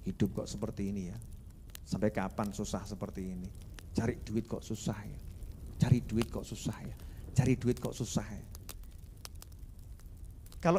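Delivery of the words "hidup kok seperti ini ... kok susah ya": low, subdued lament about life; mock world-weary complaint, under the breath; weary grumbling. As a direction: grumble softly, low and defeated.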